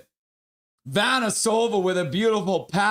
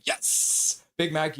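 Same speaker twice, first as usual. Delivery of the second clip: usual speaking voice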